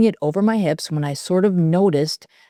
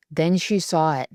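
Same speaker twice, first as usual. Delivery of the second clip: usual speaking voice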